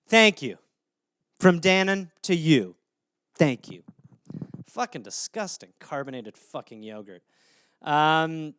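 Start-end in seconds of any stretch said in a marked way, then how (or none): 4.7-7.2 much lower, softer grumble; muttered disgust. Real, not acted. Comedian grumbling to himself about yogurt, quiet disgusted mutter. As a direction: mutter it under your breath.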